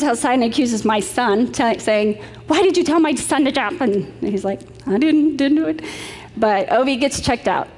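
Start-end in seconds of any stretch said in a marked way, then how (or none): 2.5-3.8 mildly freaked-out, accusing mother, pitched up; mock-indignant parent demanding an explanation. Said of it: "The mother freaks out". 4.9-5.8 higher-pitched imitation of an accused boy; a hint of a kid caught off guard; mock defensive denial. Accused boy denies, retold.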